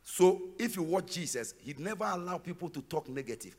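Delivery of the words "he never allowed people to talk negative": ordinary talk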